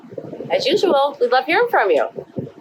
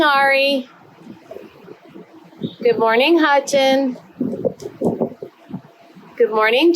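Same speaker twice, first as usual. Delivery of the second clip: bright sing-song roll call; kindergarten teacher voice; 3 semitones higher, 5 dB quieter than usual. Acted. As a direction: greet each little one warmly. Greets each child by name.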